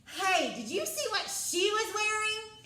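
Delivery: a stage whisper meant to reach the back row; projected stage whisper. Shown as a demonstration. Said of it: "But if I'm saying".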